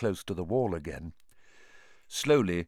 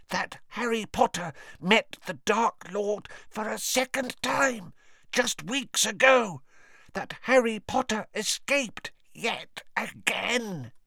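awed house-elf; 13 semitones higher than usual. A narrator's reading. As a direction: tell it with wide-eyed wonder. Dobby's voice.

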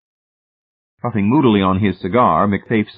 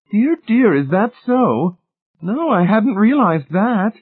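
high, slow, loud lady's astonishment; surprised dismay; 5 dB louder, slower than usual. A narrator's reading. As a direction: draw it out in high-pitched surprise. Lady's surprise continues after remark.

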